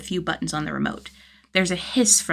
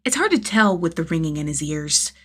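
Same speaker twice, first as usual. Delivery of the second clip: plain reading voice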